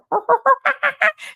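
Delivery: high, loud monkey hoots; monkey noise. Retold or imitated. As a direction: whoop like an excited monkey. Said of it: "Do a monkey noise".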